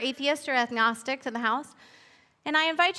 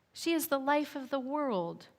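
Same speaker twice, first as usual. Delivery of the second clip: soft turtle voice; 6 dB quieter than usual. Acted. Old Turtle speaks.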